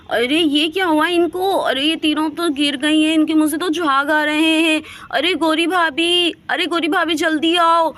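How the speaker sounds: high, loud frantic calling for help; panicked shouting. Acted. Aunt shouting for help over fallen children, loud and panicked. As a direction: yell for the neighbour in alarm. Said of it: "आप इतना तेज तेज चिल्ला रहे हो".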